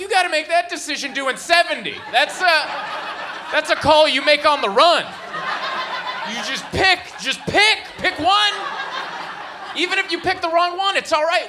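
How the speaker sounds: high, loud urgent yelling at the driver; shouted urging. Retold or imitated. Comic yelling at the Uber driver to choose a road. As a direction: push him hard to pick a lane. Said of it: "I'm like".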